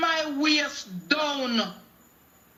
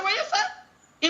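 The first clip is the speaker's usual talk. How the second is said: higher, slow retold "What you say?" through a laugh; shocked disbelief; 13 semitones higher than usual. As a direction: act out her own astonished question, half laughing. Retold shock at husband's words.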